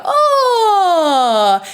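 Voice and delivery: high gliding yawn, falling down; yawn sigh. Shown as a demonstration. Coach demonstrating a morning yawn warm-up. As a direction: let out a big relaxed yawn. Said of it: "Everybody wakes up and yawns".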